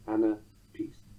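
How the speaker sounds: "anapiste" said slowly as two names, "Anna Piste"; slow, separated "anapiste", like a skier's name. Shown as a demonstration. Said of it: "as if the foot is a skiing champion".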